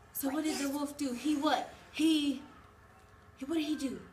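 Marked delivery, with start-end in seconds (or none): none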